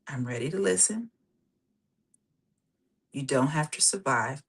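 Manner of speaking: softly guiding listeners to repeat calm affirmations; guided meditation. Acted. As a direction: ease them gently into the exercise. Leads a guided breathing exercise.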